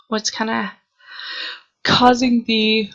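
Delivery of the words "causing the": yawning mid-word; talking through a yawn. Real, not acted. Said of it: "sorry, I'm trying not to yawn".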